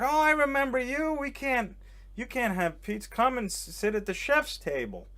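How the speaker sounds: high-pitched delighted greeting; imitated gushing hostess. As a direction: gush like a hostess spotting a guest. Acting out hostess greeting him.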